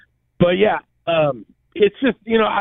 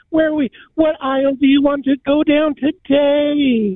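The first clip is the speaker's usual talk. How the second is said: high, lilting mock voice; mocking effeminate men; 6 semitones higher than usual. Mocking men asking which aisle to go down, high put-on voice. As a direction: imitate these men in a raised, singsong pitch. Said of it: "the men that they have around all talk like this".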